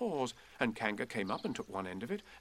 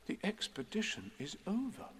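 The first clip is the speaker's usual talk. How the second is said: soft, hushed pronouncement; hushed solemnity, lifted pitch; 6 semitones higher, 10 dB quieter than usual. A narrator's reading. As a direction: announce it gravely and quietly. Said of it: "said Christopher Robin solemnly".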